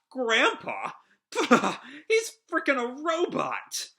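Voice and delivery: high-pitched incredulous jeer with a burst of laughter; derisive laughing. Acted. Shora laughs at Shiki's grandpa claim, derisive laughter. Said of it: "Shora just laughs".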